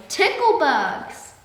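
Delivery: high, loud, very slow fading shout; man keeps shouting while fleeing; still shouting. A narrator's reading. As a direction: shout it drawn out as he runs away. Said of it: "still shouting".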